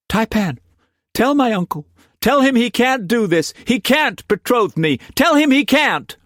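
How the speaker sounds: put-on high, urgent young woman's plea; high voiced Siobhan pleading with the Taipan. A narrator's reading. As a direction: beg him as a desperate young girl.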